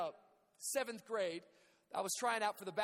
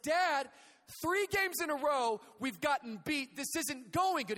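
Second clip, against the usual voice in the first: put-on higher, louder boyish complaint; frustrated son yelling; 6 semitones higher, 5 dB louder than usual. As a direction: son yelling at dad after a loss. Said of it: "I came home and said".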